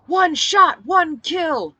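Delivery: high, loud crowd roar. Acted. The fighters answer their leader, roaring. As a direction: roar it back like a fired-up crowd. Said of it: "they roared".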